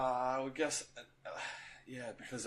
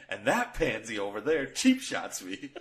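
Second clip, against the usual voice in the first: hammed-up hockey player, louder and laughing; a fan acts out a player's angry line for his co-host, laughing and louder; movie player voice; 4 semitones higher, 7 dB louder than usual. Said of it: "I think I can do this whole movie".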